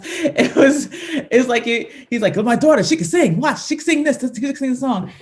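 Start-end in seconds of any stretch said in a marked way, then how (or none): 2.4-5.1 quick, high-pitched proud dad showing off his daughter; excited dad. Retold or imitated. Dad announces his daughter can sing to the gig, excited. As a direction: gush fast and high, like an excited father. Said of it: "he was just so excited".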